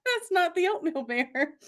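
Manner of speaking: high, laughing through his correction; real laughter over a mock husband; laughing husband quote. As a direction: quote him while cracking up. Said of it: "when he's like".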